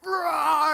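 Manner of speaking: loud, high mock bear roar. Speaker acting the escaped bear for the audience with a mock roar. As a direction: roar like a bear bursting into the room. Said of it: "and it's".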